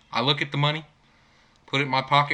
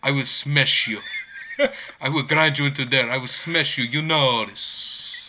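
put-on Russian fighter accent; imitation Russian accent, last words drawn out; Khabib. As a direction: threaten deadpan in a mock Russian accent.

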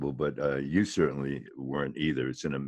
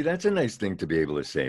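usual speaking voice

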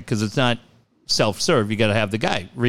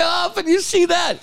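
high-pitched amused disbelief; dying laughing. Retold or imitated. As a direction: imitate asking while cracking up. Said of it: "she and I are dying".

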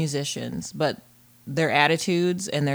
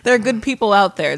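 laughing protest; 8 semitones higher, 9 dB louder than usual. Real, not acted.

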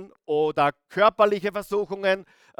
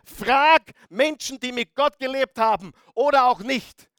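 raised, higher, louder pulpit voice; forceful preaching; 5 semitones higher, 6 dB louder than usual. Real, not acted. Preacher correcting a half-truth for the congregation, forceful and loud. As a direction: drive the point home with heavy emphasis.

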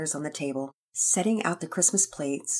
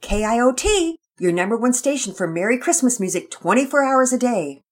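upbeat, loud station plug; radio DJ announcing her station; 8 semitones higher, 9 dB louder than usual. Acted. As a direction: sell the station on air.

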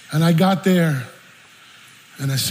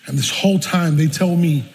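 normal talking voice

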